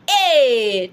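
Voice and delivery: "A" held long and loud as ay; French accented E. Shown as a demonstration. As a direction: model a long pure "A" for learners. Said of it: "you pronounce the E as A".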